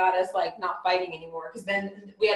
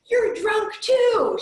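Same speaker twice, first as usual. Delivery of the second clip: high, loud, drawn-out mock yell; imitated screaming; 13 semitones higher, 5 dB louder, much slower than usual. Retelling a drunk freeway chaser, imitated screaming. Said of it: "screaming at me".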